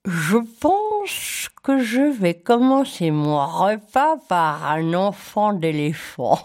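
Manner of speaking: slow crocodile voice through clenched teeth; through his teeth. Acted. Crocodile announcing his meal through his teeth. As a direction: speak through gritted teeth, savoring it. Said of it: "il parlait entre ses dents comme ça".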